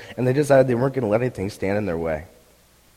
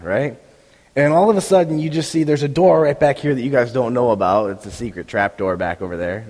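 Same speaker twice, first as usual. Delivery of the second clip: ordinary talk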